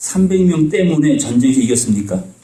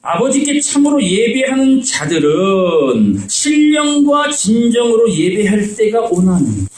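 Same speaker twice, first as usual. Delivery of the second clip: slow, raised solemn verse reading; scripture reading; 4 semitones higher, slower than usual. Acted. Preacher reading John to the congregation, slow and raised. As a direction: draw the verse out slowly, lifted.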